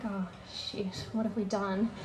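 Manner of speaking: soft, stunned gasp of guilt; hushed panic. Retold or imitated. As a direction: murderers whispering in dread. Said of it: "they're just kinda freaking out a little bit".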